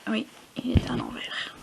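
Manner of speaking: whispered repeat. Real, not acted. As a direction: breathe it out unvoiced.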